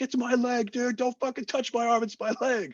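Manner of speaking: high-pitched frantic protest, retold; mock pained screaming. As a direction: re-enact desperate pleading up in falsetto. Re-enacting his pained protest to medic.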